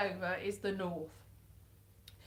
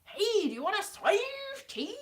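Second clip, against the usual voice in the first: high, louder mock northern English accent; imitated northern accent; 11 semitones higher, 5 dB louder than usual. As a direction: ham up the dodgy northern accent, high and loud. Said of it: "But the accent was a little bit".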